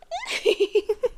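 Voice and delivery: brief high airy chuckle; soft amused laugh. Acted. Monster laughs at the uneasy human, airy and amused. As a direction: laugh softly at their discomfort.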